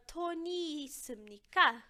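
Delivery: toni samnika said slowly with a rising question tune; teacher modelling a Korean question slowly; slow model question. Shown as a demonstration. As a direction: draw out toni samnika, lifting at the end. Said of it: "Ah, a Ana bebe leite?".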